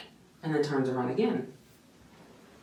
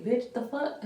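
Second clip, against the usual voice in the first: incredulous; 9 semitones higher, slower than usual. Retold or imitated. Outraged reaction to rude tech.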